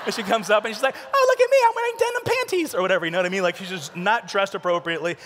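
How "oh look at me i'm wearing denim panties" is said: very high, loud mock girl voice; hammed-up falsetto girlfriend; silly girlfriend. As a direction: squeal it like a show-off airhead girlfriend. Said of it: "like, the girlfriend".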